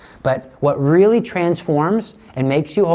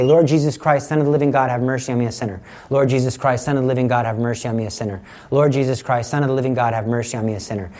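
prayer recitation; 3 semitones lower, faster than usual. Shown as a demonstration. Priest reciting prayer to show its repetition, hushed and steady.

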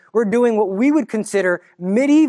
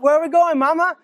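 put-on higher, innocent child voice asking; child's question; 8 semitones higher than usual. Little child asking Mary where they go, in a higher child voice. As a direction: sound like a curious little kid.

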